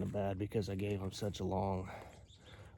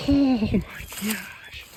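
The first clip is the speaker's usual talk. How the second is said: excited; 13 semitones higher, 10 dB louder, much slower than usual. Real, not acted. Staring at huge antlers.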